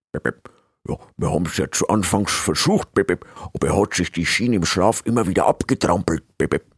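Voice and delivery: slurred creature voice with beep tics; apologetic beeping helper. Acted. Assistant explains the failed splint to master, apologetic and beeping. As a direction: make excuses in an odd beeping voice.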